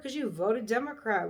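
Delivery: ordinary talk, nothing marked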